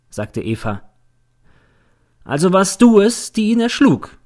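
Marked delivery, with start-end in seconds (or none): 2.2-4.2 triumphant feststellend. A narrator's reading. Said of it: "konstatiert der Verteidiger".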